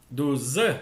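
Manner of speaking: ordinary delivery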